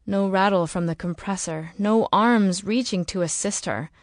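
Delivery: ordinary delivery, nothing marked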